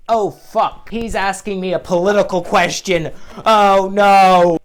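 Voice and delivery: cornered by a political question; loud rising panic; panicked. Retold or imitated. Asked a political question by boomer.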